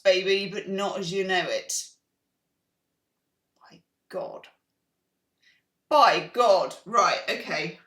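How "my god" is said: whisper. Real, not acted. Awed reaction to a card.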